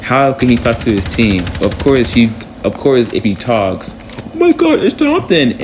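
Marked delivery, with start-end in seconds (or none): 4.3-5.6 shrill fan squeal, much higher; excited fan. Retold or imitated. Imitating chat spotting a famous streamer, excited squeal. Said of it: "the chat goes crazy".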